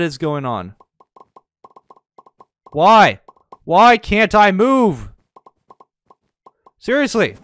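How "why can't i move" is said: normal talking voice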